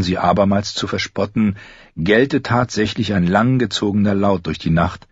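plain reading voice

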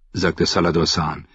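usual speaking voice

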